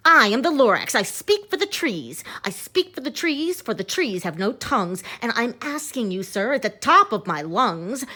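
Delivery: Lorax shouting. Acted. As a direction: shout it at the top of your lungs. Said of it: "as he shouted and puffed".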